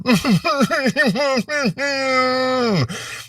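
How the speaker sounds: high strangled sob of a beaten man; pained whimpering; whimpering cry. Acted. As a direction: whimper in pain and fear. Tommy whimpering after thrown down.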